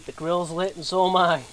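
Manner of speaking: ordinary talk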